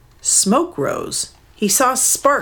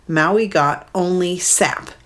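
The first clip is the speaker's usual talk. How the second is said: ordinary delivery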